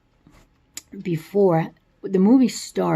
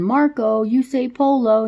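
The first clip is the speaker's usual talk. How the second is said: mild mock yell; 7 semitones higher, 5 dB louder than usual. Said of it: "and he's yelling".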